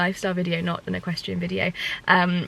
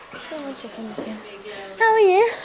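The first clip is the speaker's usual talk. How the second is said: pet talk; 11 semitones higher than usual. Real, not acted. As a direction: chirp a hello to the pony. Coaxing then greeting horse.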